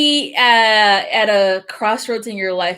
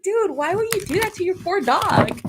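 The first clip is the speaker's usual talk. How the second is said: a high, laughing mock scolding; 11 semitones higher, 3 dB quieter than usual. She retells scolding the owner through her laughter.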